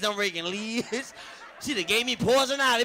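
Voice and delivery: ordinary talk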